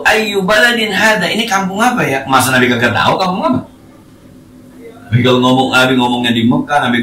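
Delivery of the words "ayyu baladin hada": raised, slow, drawn-out Arabic question; quoting the Prophet's question. Retold or imitated. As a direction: ask it slowly, a touch higher. Nabi bertanya dalam hadis. (pertanyaan Nabi, pelan dan agak tinggi)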